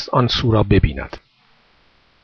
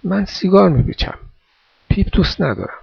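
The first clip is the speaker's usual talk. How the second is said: put-on higher, mildly fearful boy; a touch of nervous hesitation, pitched up; timid, hesitant; 7 semitones higher than usual. A narrator's reading. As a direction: answer shyly, unsure and a little afraid. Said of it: "با ترس و دودلی".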